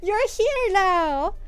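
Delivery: very high, loud, delighted squeal; thrilled greeting. Retold or imitated. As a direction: gush like seeing an old friend. Mother's thrilled greeting retold.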